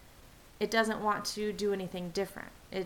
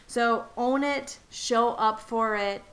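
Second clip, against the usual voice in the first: emphatic rallying call; 5 semitones higher, 7 dB louder, slower than usual. Real, not acted. Rallying listeners to stop acting little.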